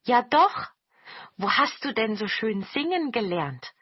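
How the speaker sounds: lively goblins clamouring to know; calling out. A narrator's reading. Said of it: "riefen die Togepi".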